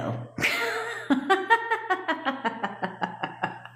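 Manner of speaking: long, high peal of laughter; helpless run of real giggles. Real, not acted. Coach finds her own scolding funny, long high laugh. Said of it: "that's why I laughed".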